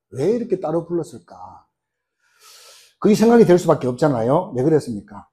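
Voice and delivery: usual speaking voice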